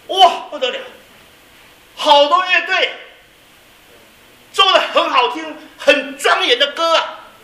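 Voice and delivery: preacher hammed up as an awestruck missionary; amazed exclamation. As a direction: burst out in awe at the grand music.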